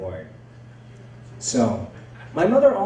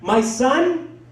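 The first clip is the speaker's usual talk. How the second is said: higher, mother buttonholing strangers; chatty mother; 6 semitones higher, 3 dB louder, slower than usual. Retold or imitated. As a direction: blurt it out to someone just met, higher. Mother accosting new acquaintances.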